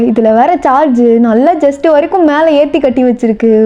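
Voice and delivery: ordinary delivery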